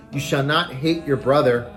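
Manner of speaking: normal talking voice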